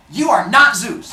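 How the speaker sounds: acted confrontational retort; indignant accusation. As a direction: call out the impostor. Acted quarrel with Zeus.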